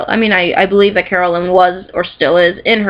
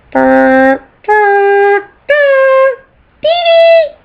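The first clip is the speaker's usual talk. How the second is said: turret beeps; 12 semitones higher, 5 dB louder, much slower than usual. Pretend. Voicing the toy turret's startup.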